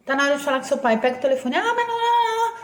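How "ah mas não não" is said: put-on whiny child refusing. Child refusing to call parent.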